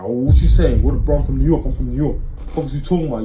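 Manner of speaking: loud, confrontational mock New York challenge; aggressive New Yorker. Mocking New Yorkers who get mad easily, loud aggressive. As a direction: bark it like you're squaring up. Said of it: "oh, they're gonna come aggressive".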